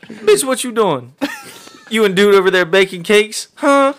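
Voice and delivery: shrill mock confrontation in a put-on voice; angry mock sentence. Friend hams up the word of the day in a sentence, high, slow and loud. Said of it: "You have to use a different accent every time".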